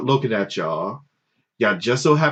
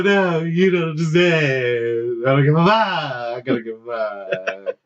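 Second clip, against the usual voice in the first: slow dismissive; 2 semitones higher than usual. Retold or imitated.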